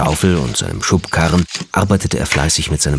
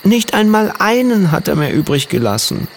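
sobbing; 13 semitones higher than usual. A narrator's reading. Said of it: "schluchzte er".

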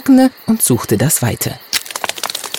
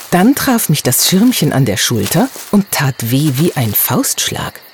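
plain reading voice

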